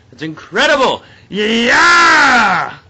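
high, loud yell with a drawn-out scream; triumphant cheer. Real, not acted. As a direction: let out a huge victory whoop. Celebrating the win.

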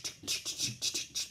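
soft voiceless "ch-ch" percussion; imitated rhythm intro. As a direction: chug out the intro groove with breath alone. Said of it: "then they go in the".

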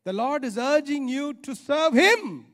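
ordinary talk, nothing marked

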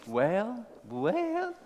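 drawn-out call climbing into high falsetto; mounting high churchwoman's approval; church women responding. Acted. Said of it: "he was hearing".

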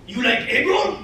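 showman chef rising to a high, loud cry; hammed-up hibachi chef. Comedian mimics chef putting on a show, hammed-up calling. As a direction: build from a question to a high, loud show call.

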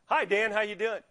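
imitating the boss turned pleasant; mock chummy hello to the new guy; suddenly friendly. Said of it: "Totally changed".

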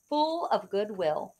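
ordinary delivery, nothing marked

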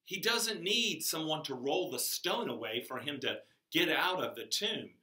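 ordinary talk

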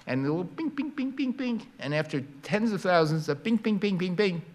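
high light pings, one after another; little pinging sounds. Shown as a demonstration. Demonstrating photons hitting an atom, high pings. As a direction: tap out tiny ping noises.

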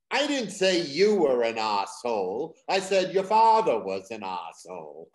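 loud overheard Boston spat; Boston accent. Retold or imitated. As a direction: argue loudly in a thick Boston accent. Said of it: "the following conversation. She said".

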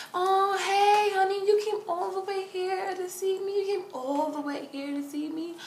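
high, cooing imitation; touched greeting. Prodigy grabbing her hand, touched cooing. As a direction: coo sweetly in a high voice.

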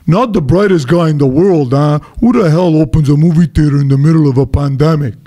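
a hint of a scornful radio caller; mocking caller. Caller on the radio sneering at the theater owner, a hint of mock scorn. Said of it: "called into a radio station and said".

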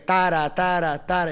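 "tara" three times, each first syllable accented; bouncy even pulse on "tara, tara, tara"; musicality demo. Shown as a demonstration. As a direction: beat out "tara" like a drum pattern. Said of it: "esa es la musicalidad".

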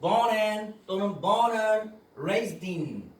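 born and를 born an으로 느리게; reduced and. Shown as a demonstration. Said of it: "and on 될 겁니다". (and 축약 시범)

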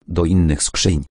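plain reading voice

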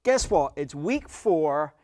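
slow, drawn-out announcement climbing high; announcer build-up. Pretend. Host announcing the halfway point, hammed-up announcer. Mock announcer reveal of the week.

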